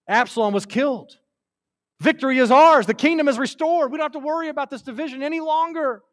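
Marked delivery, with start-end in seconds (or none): 2.0-6.0 high, elated victory announcement; triumphant messenger. Acted. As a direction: announce the good news loudly, then keep it high and rushing. Messenger brings victory news to David.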